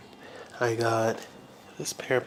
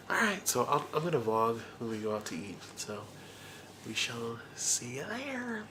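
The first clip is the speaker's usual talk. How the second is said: normal talking voice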